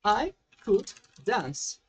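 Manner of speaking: very slow, each word apart; slow teaching read. Shown as a demonstration. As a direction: dictate it word by word. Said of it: "Vamos usar o could agora".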